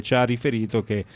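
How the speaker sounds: ordinary delivery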